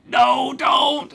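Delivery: high-pitched shouted protest; mock panicked plea. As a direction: cry out to stop a friend, hammed up. Silently begging buddy to stop.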